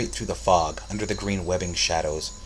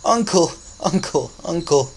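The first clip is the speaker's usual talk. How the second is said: high, overjoyed repeated cries; happy sobbing; 11 semitones higher, 6 dB louder than usual. A narrator's reading. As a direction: cry out, overcome with happiness. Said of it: "sobbing happily".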